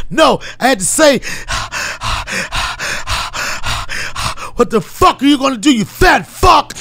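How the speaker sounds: profane motivational rant while jogging, huffing between bursts; David Goggins impression. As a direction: spit out self-tough sermon mid-run with heavy panting. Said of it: "incredible David Goggins".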